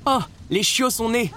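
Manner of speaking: high, thrilled announcement; excited cry. A narrator's reading. Nani announcing the puppies to the kitchen, excited cry. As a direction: burst in with joyful news. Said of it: "s'écria-t-elle".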